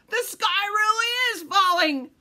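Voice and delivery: high, loud mock-terrified yelp; shriek. A narrator's reading. Fox hit on the head, high startled shriek. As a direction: shriek it like a cartoon villain. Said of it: "shrieked Foxy Loxy".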